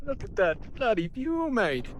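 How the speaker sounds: high, slow put-on Aussie accent; hamming up an Aussie tourist; playful Aussie accent impression; mock Aussie accent. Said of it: "I'm not trying to chop anybody's nice, fine, worldwide accent".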